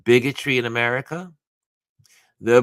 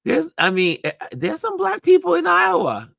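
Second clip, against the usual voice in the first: very high, loud squeal through laughter; laughing mock surprise; 5 dB louder than usual. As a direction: squeal it in gleeful disbelief. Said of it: "I was like".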